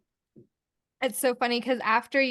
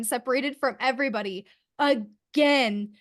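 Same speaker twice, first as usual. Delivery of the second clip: ordinary talk, nothing marked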